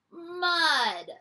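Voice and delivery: ordinary delivery, nothing marked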